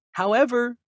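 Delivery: ordinary talk, nothing marked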